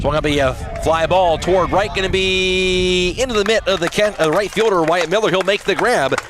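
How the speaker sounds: announcer calling the catch in right; excited call. Real, not acted. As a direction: lift the voice as the ball carries. Fly ball to right field.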